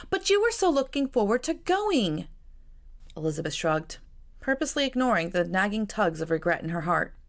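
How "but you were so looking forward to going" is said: Jane's voice. A narrator's reading. Jane pleads with sister.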